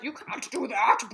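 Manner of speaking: a hint of a laughing scolder; lightly mock modern critics, laughing. Mocking modern readers scolding the author, laughing. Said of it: "They're like".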